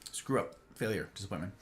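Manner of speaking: low, very soft aside; mock self-reproach. Streamer admitting forgetfulness, muttered mock self-reproach. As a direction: trail down into a glum mumble.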